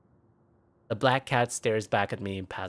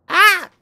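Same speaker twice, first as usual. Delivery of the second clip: sudden scream, much higher and louder; startled scream; 14 dB louder than usual. Acted. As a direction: shriek in shock. Something hits her back.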